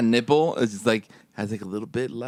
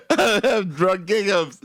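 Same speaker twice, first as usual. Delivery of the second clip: higher, slower laughing shout; 7 semitones higher than usual. Real, not acted. Exclaims while laughing.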